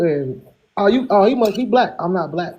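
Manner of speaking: ordinary talk, nothing marked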